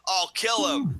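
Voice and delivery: high, loud mock outrage; imitating furious threat. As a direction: burst out with a hammed-up threat. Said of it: "Mac assumes it's Marty".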